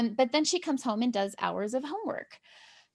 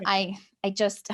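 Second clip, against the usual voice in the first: ordinary talk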